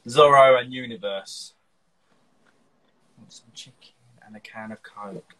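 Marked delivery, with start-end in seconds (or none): 3.2-5.3 whispered read of a message; barely audible, unvoiced murmur; under the breath. Real, not acted. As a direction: whisper it to yourself while reading. Reading viewer's request under breath.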